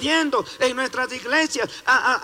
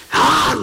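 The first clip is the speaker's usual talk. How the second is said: mock wolf cry; 5 semitones higher, 7 dB louder than usual. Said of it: "Y el lobo te dice".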